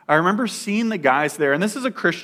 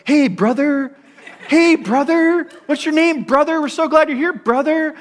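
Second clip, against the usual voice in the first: much higher, mocking churchy greeting; mock Christianese brother talk; 12 semitones higher, 6 dB louder than usual. Imitating rehab guys greeting a newcomer, in a high mock-sweet voice. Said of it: "they had this Christianese language".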